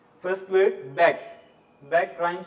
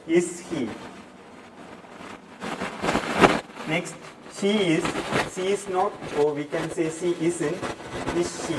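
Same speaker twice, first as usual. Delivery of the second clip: loud classroom drill; 8 dB louder than usual. Acted. Teacher drills be verbs aloud.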